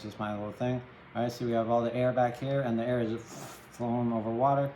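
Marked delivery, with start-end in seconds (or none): none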